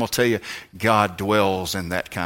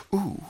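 high soft wince; as if touching a sore spot; pained ooh; 10 semitones higher, 7 dB quieter than usual. Acted. Preacher wincing at a tender spot, soft and high.

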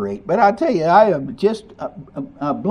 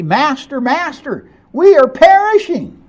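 rising higher and louder to a panicked wail; desperate cry; 8 semitones higher, 5 dB louder than usual. Acted. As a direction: call out for help as the boat sinks. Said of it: "they cried out".

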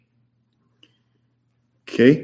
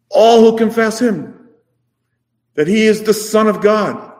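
higher, louder, building preacher's cry; rising preaching; 10 semitones higher, 4 dB louder than usual. Acted. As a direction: lift the congregation with each word.

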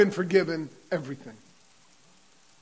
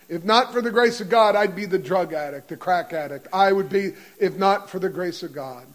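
usual speaking voice

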